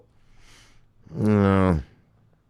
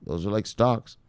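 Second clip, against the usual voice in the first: usual speaking voice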